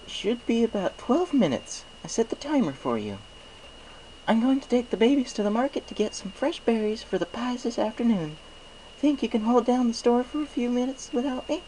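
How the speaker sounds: high, sweet motherly pony voice; sweet mayor voice. Acted. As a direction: lift it up high and kindly for the baker's wife. Mayor speaks to Mister Cake.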